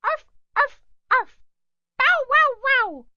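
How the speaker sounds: high yappy barks; dog barking. A narrator's reading. Little dog warning off the pirate, high yaps. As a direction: bark like a small dog, high and loud. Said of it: "she said".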